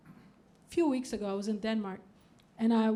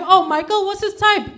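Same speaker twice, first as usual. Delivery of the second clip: eager nosy question; 8 semitones higher, 8 dB louder than usual. Retold or imitated.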